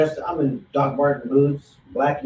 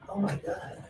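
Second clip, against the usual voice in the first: breathy awe; 4 semitones higher, 12 dB quieter than usual. Retold or imitated. Awed reaction to peaceful bar.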